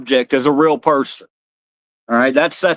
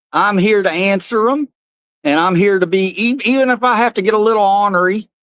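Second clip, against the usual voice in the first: Cowboy Carl; 3 semitones higher than usual. Pretend. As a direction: stretch out the howdy, much higher. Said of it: "Say hello to Cowboy Carl".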